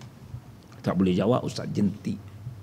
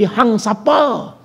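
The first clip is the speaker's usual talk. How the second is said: slow, curt challenge, a touch higher; rude retort; 5 semitones higher, much slower than usual. Retold or imitated. Stranger rebuffed, answering curtly and slowly. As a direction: throw back a curt who-are-you. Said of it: "dijawab macam tadi".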